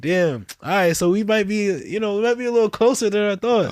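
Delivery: high, loud amazed realization; like a sudden happy discovery; excited. Retold or imitated. Excited realization about Brazil.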